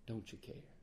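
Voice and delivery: soft despairing echo to the Lord; faint plea. Shown as a demonstration. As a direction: let the question fade almost to nothing. Pleading prayer quoted, repeated.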